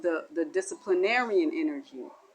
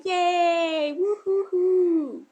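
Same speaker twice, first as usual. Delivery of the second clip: higher, louder, stretched-out cheer; gleeful cheer; 10 semitones higher, 5 dB louder, much slower than usual. Acted. Finally handed the treat, a loud stretched cheer. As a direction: cheer like you just won.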